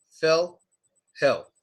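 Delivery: "Phil" and "hill" with short, relaxed ih; no weight on the vowel in "Phil", "hill"; unstressed short i. Shown as a demonstration. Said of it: "there's no stress on the vowel sound".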